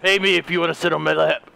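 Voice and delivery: deep, low Santa voice; seedy mall Santa. Acted. Santa touting his lap for money, seedy.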